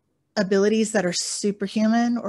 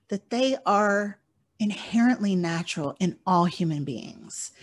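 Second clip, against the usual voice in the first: ordinary talk, nothing marked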